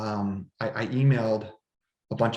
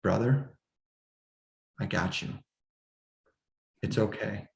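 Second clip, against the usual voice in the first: calm reassurance; 6 dB quieter than usual. Retold or imitated. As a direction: gently reassure a brother in crisis, softly and unhurried. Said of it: "just like this".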